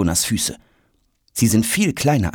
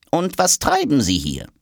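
curious question, pitched up; 8 semitones higher than usual. A narrator's reading. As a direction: inquire with lively interest. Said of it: "erkundigt sich die Diplomatentasche neugierig". (neugierig nachhakend)

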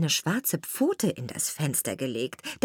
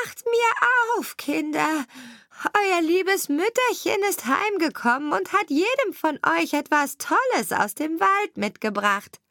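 wolf faking mother; 10 semitones higher than usual. A narrator's reading. Wolf faking mother third time.

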